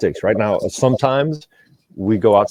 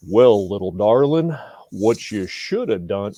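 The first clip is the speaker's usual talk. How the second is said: John Wayne; 4 dB louder than usual. Acted. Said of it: "it was John Wayne himself".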